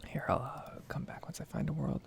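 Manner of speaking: usual speaking voice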